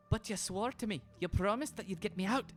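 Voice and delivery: pleading Caroline. Acted. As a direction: beg in a high, anxious voice. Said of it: "she's like".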